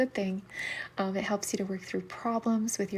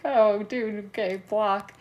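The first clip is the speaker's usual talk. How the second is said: dismissive brush-off; 9 dB louder, slower than usual. Retold or imitated. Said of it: "I was like".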